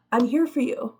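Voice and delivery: usual speaking voice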